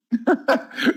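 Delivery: sudden high laugh; short high laugh at own misfortune; high burst of laughter. Real, not acted. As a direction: let a bright laugh burst out. After bad stock news.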